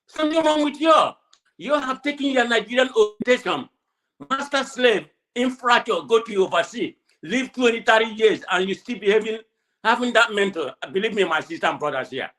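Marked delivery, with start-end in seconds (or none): 0.1-10.7 retold outburst at diaspora Nigerians. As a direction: scold them, pitch up and push. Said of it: "I said".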